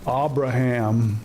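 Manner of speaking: Abraham stretched slowly as AH-bruh-ham; fumbling Abraham as a slow AH-bra-ham; mispronounced name. Retold or imitated. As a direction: drag out Abraham, opening on a broad AH. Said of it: "someone trying to pronounce acetaminophen".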